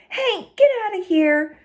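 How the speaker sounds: high, yelling rider; yelling cowboy. Acted. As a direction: yell the dog away from the colt. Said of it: "he started yelling".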